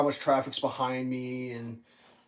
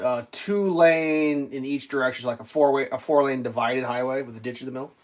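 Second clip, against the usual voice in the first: ordinary talk, nothing marked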